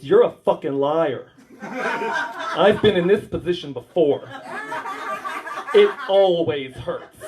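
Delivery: slow, lightly camp retort; a hint of camp drawl; flamboyant patient. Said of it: "he goes".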